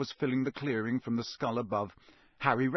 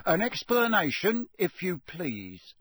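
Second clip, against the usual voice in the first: raised, accusing Ministry official; stern official; 6 semitones higher, 3 dB louder than usual. A narrator's reading. Diggory questioning the elf, stern and accusing. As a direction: demand an explanation from the elf. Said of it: "said Mister Diggory".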